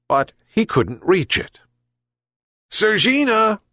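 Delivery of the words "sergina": called out. A narrator's reading. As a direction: call the cat from the sickbed. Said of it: "called the old man".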